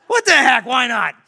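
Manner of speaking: loud, high, gleeful shout; throwing caution away. Retold or imitated. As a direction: burst out with a reckless yes.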